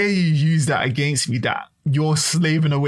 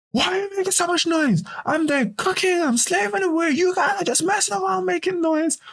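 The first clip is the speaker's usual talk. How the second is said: much higher, shrill-pitched mock mum scolding; nagging mother. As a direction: impersonate an exasperated mum complaining. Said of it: "she comes in and goes".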